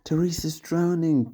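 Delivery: high, sobbing wail; sobbed. A narrator's reading. Kelly sobbing about her drowning doll, high pitched. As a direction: wail it through tears. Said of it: "Kelly sobbed".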